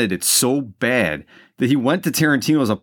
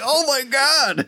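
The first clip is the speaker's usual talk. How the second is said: usual speaking voice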